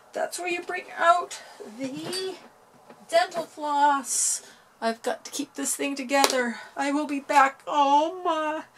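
high, loud and frantic; flustered. Real, not acted. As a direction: rush about in a panic. Flustered after bracelet breaks.

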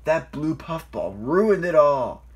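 loud, slow, heated blame; high, loud furious complaint; angry growl. A narrator's reading. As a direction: rage at the culprit, dragging the words. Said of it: "growled Airy".

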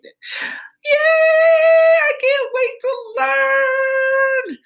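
put-on high, slow cheering little girl; excited child. Chantel cheering to Miss Gregory, high and delighted. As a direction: cheer like a thrilled kindergartner.

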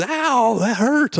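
high-pitched imitation of a hurt cry; mock pained yelp. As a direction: yelp it like your foot got kicked. Acts friend kicked in sore foot.

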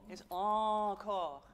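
"encore" slow with nasal first vowel. Shown as a demonstration. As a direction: stretch "encore", nasal then open. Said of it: "No, you'll go encore".

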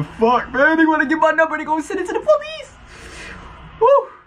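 high, rising panicked squeal; mock panic. Real, not acted. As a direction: freak out in rising pitch. Panicked joking outburst.

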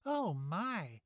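high delighted gasp of joy; gleeful exclamation. Acted. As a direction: squeal it with glee. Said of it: "she exclaimed with glee".

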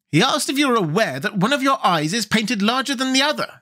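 put-on high-pitched, mischievous girl. A narrator's reading. Girl mistranslates to tease the king, mischievously. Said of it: "said the girl mischievously".

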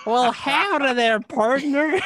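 cartoon cowboy accent. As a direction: play up a silly Western cartoon accent. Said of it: "Pete wants to hear your accent".

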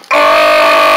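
long, low, loud groan; drawn-out cry after a smack; pained groan. Acted. Tun gets smacked.

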